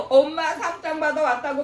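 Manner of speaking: put-on high, eager child shouting home; a kid bursting in with good news; excited child calling. Child running home to mom, excitedly calling out.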